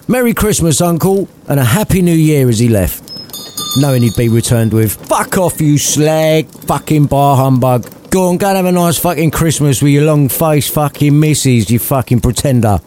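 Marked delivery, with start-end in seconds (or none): 0.1-2.5 yelled. Acted. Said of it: "He yelled". 4.9-12.8 lightly voiced cranky old man; sweary Scrooge. Scrooge swearing at his departing nephew, lightly voiced.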